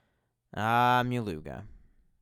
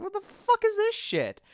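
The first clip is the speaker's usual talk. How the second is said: shocked disbelief; 3 dB louder than usual. Retold or imitated. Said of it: "I'm just like".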